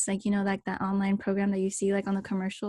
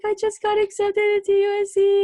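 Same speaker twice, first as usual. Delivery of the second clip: much higher and louder, crying out the news; overjoyed, voice cracking with tears; tearful excitement; 12 semitones higher, 11 dB louder than usual. Retold or imitated. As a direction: blurt it through happy tears. Retelling tearful acceptance news.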